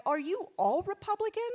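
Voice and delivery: naive high question. Retold or imitated. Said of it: "say things like".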